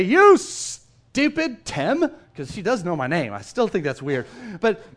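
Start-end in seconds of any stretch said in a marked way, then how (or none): 0.0-2.1 slow, loud mock yell at Tim; pretend yell. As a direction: bark the insult slowly, hammed-up. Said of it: "She didn't yell at me and say".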